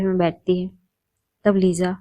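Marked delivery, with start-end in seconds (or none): none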